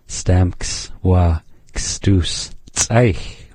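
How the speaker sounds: Stamks wa kstuch said very slowly, syllable by syllable; teacher slowing right down; slow demo. Shown as a demonstration. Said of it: "Hear that ending change?".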